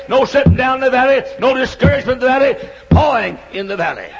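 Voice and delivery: preacher rousing the congregation with music; preaching at full force. Acted. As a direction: drive it home to the widows and fathers. Sermon peak over organ music.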